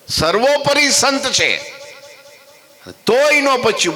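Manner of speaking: high, slow, emphatic preaching; climbing, drawn-out sermon emphasis; rising emphasis. Real, not acted. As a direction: lift it high and drag it out for weight. Preacher stressing the saint's greatness.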